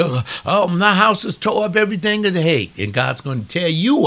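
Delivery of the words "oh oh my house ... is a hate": high, loud mocking wail; mock wailing mourner. Preacher mocking a grieving homeowner, high and loud. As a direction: ham up a grieving homeowner's lament. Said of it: "when you lose".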